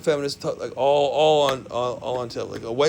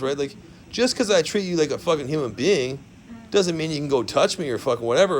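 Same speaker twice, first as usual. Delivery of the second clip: ordinary talk, nothing marked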